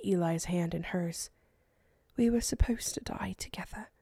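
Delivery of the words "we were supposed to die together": soft grieving whisper; murmured whisper. A narrator's reading. Eleonora beside her dying husband, whispered. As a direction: whisper it to him like a vow. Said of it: "she murmured".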